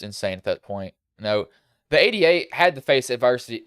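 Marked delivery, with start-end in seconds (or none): 1.9-3.6 louder country twang slipping out; country accent. Real, not acted. Race commentator recapping the winner's troubles, country accent. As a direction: let a real country accent slip out. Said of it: "that's my country voice coming out".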